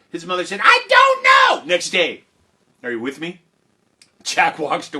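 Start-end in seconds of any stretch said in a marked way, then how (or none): 0.6-1.7 very high, loud, slow screech; exasperated shriek. Acted. Mother answering clueless Jack, exasperated shriek. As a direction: wail it at the end of your rope.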